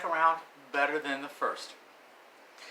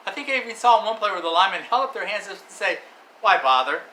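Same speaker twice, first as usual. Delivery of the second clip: much louder, higher game call; excited play-by-play; 6 semitones higher, 10 dB louder than usual. Acted. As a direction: build the hype of the huge throw.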